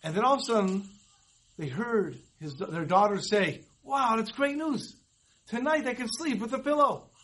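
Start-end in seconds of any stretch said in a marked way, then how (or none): 3.8-7.1 put-on excited teen. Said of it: "he sounded very excited".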